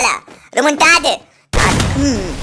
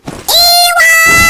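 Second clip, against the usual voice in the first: drawn-out yell, much higher; hype shout; 13 semitones higher, 4 dB louder, much slower than usual. Real, not acted. Gamer celebrating a kill, long high hype yell. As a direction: stretch the yes into a triumphant yell.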